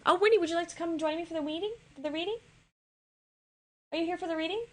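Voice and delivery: high, quick call to a friend. Real, not acted. Calling to Winnie to join the reading, a high bright call. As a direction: invite her over from across the room.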